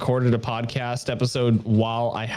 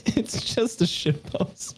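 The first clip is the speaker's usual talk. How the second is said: laughing; 10 semitones higher, 4 dB quieter, slower than usual. Real, not acted. Echoing partner, laughing.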